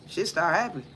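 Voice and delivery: murmured afterthought "Shit start happening"; quiet echo. Shown as a demonstration. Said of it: "But then the shit start happening".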